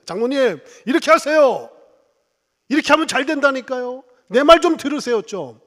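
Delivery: loud, high pushy demands; bossy insistence. Retold or imitated. Proud pastor bossing the elder, loud mock insistence. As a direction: imitate lecturing the elder. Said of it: "라고 할 텐데".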